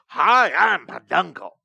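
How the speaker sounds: cheerful mascot voice shouted out; bright squeaky sing-song welcome. Pretend. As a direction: greet like a kids' theme park mascot. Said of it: "Sounds like some children's mascot".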